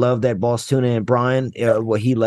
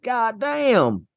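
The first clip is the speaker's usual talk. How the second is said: mock amazement; 9 semitones higher than usual. Shown as a demonstration. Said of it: "it's like".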